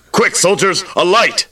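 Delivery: high, hurried order to soldiers; high-pitched urgent calling; urgent command. Acted. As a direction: call out for help at once. Jailer orders soldiers to bring light.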